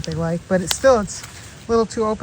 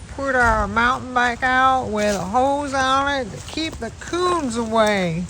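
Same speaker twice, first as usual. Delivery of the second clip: slow, drawn-out attempt at a Southern American accent; bad Southern accent; 3 semitones higher, slower than usual. Shown as a demonstration. Vlogger trying to speak Southern American about the mountain bike, bad Southern accent. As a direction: put on a clumsy, slow Southern twang. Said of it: "that was a horrible accent".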